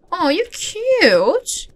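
slow, high-pitched coo; adoring coo. Real, not acted. Player meeting a cute horse, slow high coo. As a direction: draw it out, cooing high at an animal. Cooing at a horse she found.

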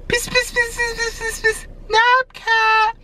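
very high sing-song call, drawn out on Napcat. Acted. Vlogger calls for the cat in a very high sing-song chant. Calling softly for hiding cat.

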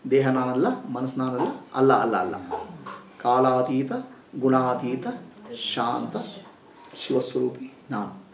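hushed meditative recitation; quiet affirmations. Acted. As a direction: murmur each phrase inward like a mantra.

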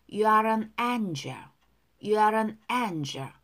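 slow, even teacher's model line; slow model reading. Shown as a demonstration. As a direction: spell each word out slowly. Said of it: "두 번씩 따라 할게요". (천천히 또박또박 시범 발음)

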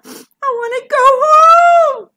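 put-on loud, high childish wail; crying. A narrator's reading. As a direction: wail it out, drawing out the end. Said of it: "Bertie started to cry".

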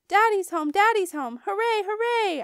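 high, loud cheering; joyful yell. A narrator's reading. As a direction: cheer like an overjoyed kid. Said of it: "I yelled".

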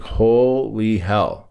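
drawn-out, emphatic reaction of astonishment; stunned disbelief. Retold or imitated. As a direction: stretch it out slowly in stunned amazement. Stunned reaction to therapist's diagnosis.

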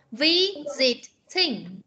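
"Visiting" very slow, vi... zi... ting; corrective three-syllable model. Shown as a demonstration. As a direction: break "Visiting" into three stretched beats. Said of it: "Chưa đúng".

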